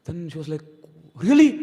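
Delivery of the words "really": high startled cry of disbelief; shocked really. Acted. Radharani hearing she was replaced, shocked and high. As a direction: gasp it out in hurt surprise. Radharani hears no one waits.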